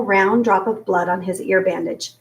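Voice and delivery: ordinary delivery, nothing marked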